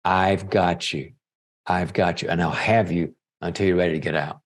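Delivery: low, steady comforting voice; gently repeated fatherly comfort; tender reassurance. Acted. Father comforting daughter in the well.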